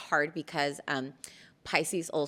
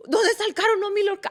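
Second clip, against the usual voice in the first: high, loud father voice; worried dad; 8 dB louder, much faster than usual. Retold or imitated. Dad questions his daughter, high and loud. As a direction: play the fretting dad. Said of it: "He's like".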